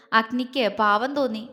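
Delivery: plain reading voice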